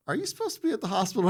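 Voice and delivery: high, quick disbelieving question; incredulous. Retold or imitated. Colonel asks the battered Marine in high, quick disbelief. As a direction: sound amazed he's even standing there.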